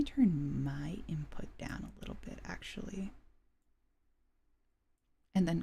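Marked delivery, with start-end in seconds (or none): 0.0-3.1 whispered aside; whispering. Real, not acted. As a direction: whisper it off-mic. Aside while adjusting levels.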